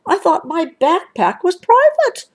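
high, aggrieved protest; indignant Brother. A narrator's reading. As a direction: object that your privacy was invaded. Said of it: "said Brother".

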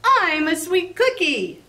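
high bright cookie voice, proud; cookie shouting. Acted. Fresh cookie bursting out the bakery door, shouting high. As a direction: announce yourself like a boastful little cookie. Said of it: "burst out the front door and shouted".

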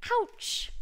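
high, sudden yelp of pain. A narrator's reading. Narrator voices the stung king, pained yelp. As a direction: cry out as if stung.

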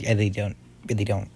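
normal talking voice

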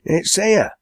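high-pitched sulky reply; a touch of a sulk. Acted. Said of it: "replied Enoch sulkily".

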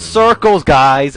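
loud, high call-out; urgent shout. Real, not acted. Gamer telling viewers to run zombie circles, urgent shout. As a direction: yell the tactic out to everyone. Calling out tactic amid zombies.

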